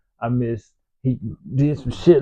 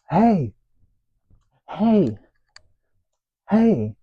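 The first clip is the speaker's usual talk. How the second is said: mock girls calling out; 7 semitones higher, much slower than usual.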